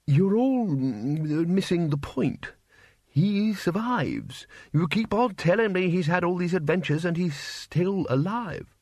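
lightly voiced old don making his case; elderly wizard arguing. Elderly wizard argues, slow start.

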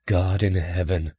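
slow, hushed awe; awed exclamation. A narrator's reading. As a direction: breathe it out in wonder. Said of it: "Franks exclaimed".

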